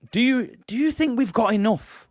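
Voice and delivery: high, baffled challenge; incredulous challenge. Real, not acted. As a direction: dare them to say yes. Challenging viewers in debate.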